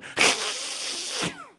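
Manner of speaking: high, breathy unvoiced shriek; creature screech. Storyteller voicing the Talipo creature, high breathy shriek. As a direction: let out a swamp creature's screech. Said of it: "and it's going".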